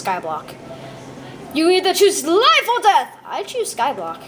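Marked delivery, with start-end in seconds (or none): 1.5-3.2 dramatic booming voice. Pretend. Kid voices a booming ultimatum.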